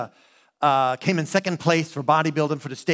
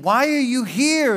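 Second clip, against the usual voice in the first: startled question; 8 semitones higher than usual. Retold or imitated. Startled at girl in room.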